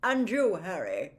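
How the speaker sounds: put-on jovial, booming old professor; plummy teacher inspecting the class's work; Slughorn's voice. Slughorn to the class, checking potions, jovial booming.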